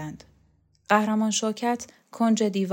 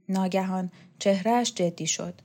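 usual speaking voice

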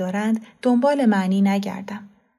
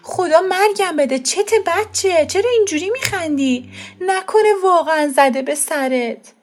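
high, loud panicked cry from a scared mother; high-pitched horrified outburst; frightened mother; 9 semitones higher, 4 dB louder than usual. Acted. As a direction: shriek it out in alarm over the laughing child. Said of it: "وحشت زده به من نگاه کرد و گفت".

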